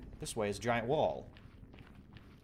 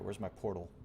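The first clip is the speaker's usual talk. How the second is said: usual speaking voice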